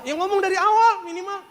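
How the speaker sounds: high exasperated protest; protes kesal. Retold or imitated. Retold exasperated complaint. (protes gemas bernada tinggi)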